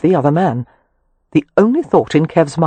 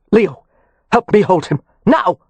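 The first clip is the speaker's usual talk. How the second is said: urgent call; 4 semitones higher than usual. A narrator's reading. Rowan calling Leo over while wrestling Kev, urgent.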